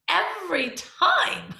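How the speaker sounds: high, slow, laughing repeat; real laughter. Real, not acted. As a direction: laugh it out, rueful.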